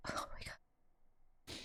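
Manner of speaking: barely voiced, awed; breathless whisper. Real, not acted. Player stunned by the emotional scene, whispered. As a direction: breathe it out in awe.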